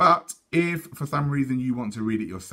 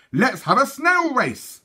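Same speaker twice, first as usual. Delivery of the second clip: high excited twins; 6 dB louder, slower than usual. Acted. Twins proposing a race.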